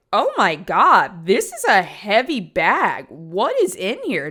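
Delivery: loud, slow mock strain at a heavy bag; a driver grunting at a heavy bag, loud and slow; imitating cab driver. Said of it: "he's like".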